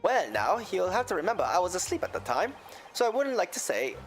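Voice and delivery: a lilting Irish brogue; Irish accent. Acted. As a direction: tell it like an Irish witness. McGilded in accent.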